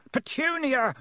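angry uncle roaring a name; roared. Acted. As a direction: roar for his wife down the house. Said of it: "roared Uncle Vernon".

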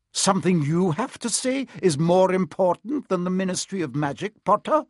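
stern teacher doubting a pupil; withering question, surname tacked on sharply; scornful professor. A narrator's reading. Professor scornfully challenges Harry.

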